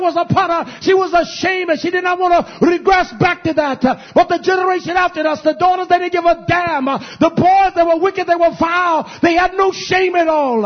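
loud, high, driving sermon; full-force preaching. Acted. Preacher thundering at the congregation, loud.

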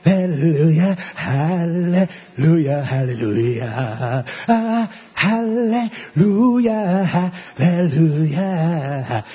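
high, loud, drawn-out preaching chant; fervent preaching. Acted. Preacher leading his congregation in drawn-out hallelujahs. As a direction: stretch every hallelujah like a revival cry.

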